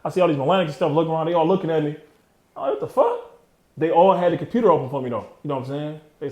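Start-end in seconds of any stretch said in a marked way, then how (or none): 2.6-3.2 a sudden high yelp of disbelief; shocked exclamation. Real, not acted. Walking into the law library, everyone staring, high shocked outburst. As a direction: jump up in pitch, stunned.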